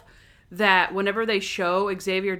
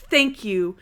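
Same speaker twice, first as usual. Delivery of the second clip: mock-aggressive list of thank-yous, building higher; 5 semitones higher, 4 dB louder than usual. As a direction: fire off each thank-you at them, getting more heated. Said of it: "It's so aggressive".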